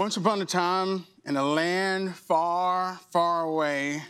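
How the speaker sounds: slow storybook opening; fairy tale opening. Acted. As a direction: draw out the fairy tale start. Opens sermon story.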